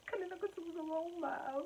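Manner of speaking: high, tearful little wail; hammed-up weepy squeak; mock crying. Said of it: "Why are you crying?".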